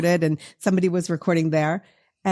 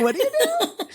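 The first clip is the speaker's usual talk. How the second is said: laughing mock disbelief; faster than usual. Mocking outsiders, laughing.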